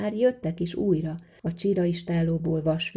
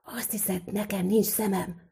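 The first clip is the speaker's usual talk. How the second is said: forceful stage whisper, partly voiceless; 3 semitones higher than usual. A narrator's reading. Smith whispering back to the boy about the devil, whispered. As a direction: whisper it back, starting strong then dropping. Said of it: "sukta vissza Szűcs Lajos".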